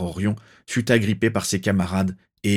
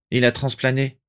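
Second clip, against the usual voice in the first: restrained, slightly raised reporting voice; let slip; 5 semitones higher than usual. A narrator's reading. Lupin tells the family the three have fled, restrained. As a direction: break the news without shouting. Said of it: "lâcha Rémus Lupin".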